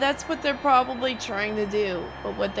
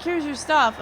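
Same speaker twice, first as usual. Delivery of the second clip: higher, louder mock of mom handing it over; imitating mom; 5 semitones higher, 6 dB louder than usual. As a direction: play the offhand mom, a bit hammed-up. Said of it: "Be like".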